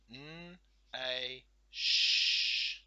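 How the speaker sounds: N, a, sh sounded, sh hissed loudly; segmenting sounds. Shown as a demonstration. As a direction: hiss the sh hard.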